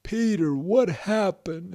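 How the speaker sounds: high, drawn-out questioning; demanding Grandpa. Acted. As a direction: stretch the question out as an alarmed grandfather. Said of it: "And the grandpa said".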